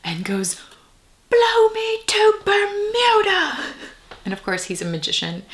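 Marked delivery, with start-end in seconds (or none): 1.3-3.7 high, slow, cracking wizard cry; exasperated shriek, much higher and louder; frustrated Merlin. Retold or imitated. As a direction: wail it like a fed-up old wizard. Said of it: "Merlin gets really frustrated and goes".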